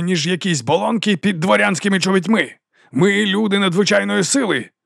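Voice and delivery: high, sustained rallying speech; ringing oration. A narrator's reading. Captain praises the mercenaries' skill, ringing oratory. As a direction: proclaim the troops' prowess. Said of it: "Його голос дзвенів".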